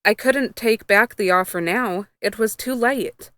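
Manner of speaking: usual speaking voice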